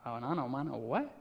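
soft, low aside as a puzzled listener; lightly voiced confused listener. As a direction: stumble over the word, quietly baffled. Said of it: "You're like".

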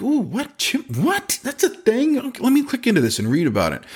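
very high, hammed-up surprise; mock curious job seeker. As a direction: act amazed at a chimney sweep ad.

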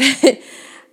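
sudden loud burst of laughter. Real, not acted. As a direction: break out laughing, loud and high. Laughs at her own stutter.

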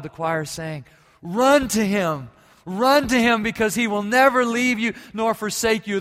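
loud, high-pitched preaching from the pulpit; full-force sermon delivery; fervent preaching. Acted. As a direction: preach it out to the whole church.

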